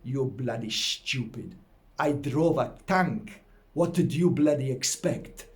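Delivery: put-on mild Polish accent, slow and gruff; a hint of an angry Polish father; Polish accent dad. Angry Polish father snapping at his son's question. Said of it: "because he was Polish".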